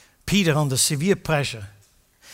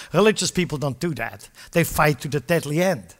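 ordinary delivery

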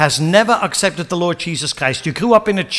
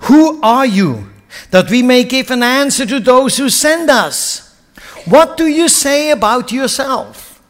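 demanding question; 5 semitones higher, 4 dB louder than usual. Acted. Delegation pressing John.

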